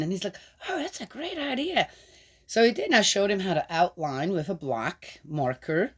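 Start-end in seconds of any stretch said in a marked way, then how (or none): 0.7-1.9 grandson's eager reply, pitched up; lightly voiced grandson, higher; voicing grandson. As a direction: pitch it up for the grandson's happy yes.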